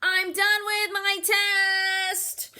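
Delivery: put-on high, loud kid blurting out; a little girl too loud for the library; loud child voice.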